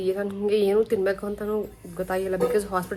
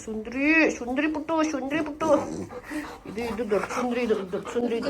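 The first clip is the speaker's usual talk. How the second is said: pet talk; 4 semitones higher, 3 dB quieter than usual. Real, not acted. Owner cooing to her pet, calling it beautiful, in high baby talk.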